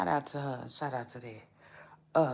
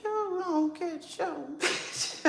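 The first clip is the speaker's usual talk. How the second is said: high put-on sass; 13 semitones higher, slower than usual. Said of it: "I said".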